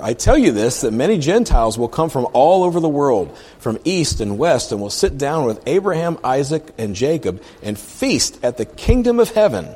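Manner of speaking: high, loud declamation; proclaiming scripture. Acted. Jesus speaks to the crowd about faith, loud proclaiming.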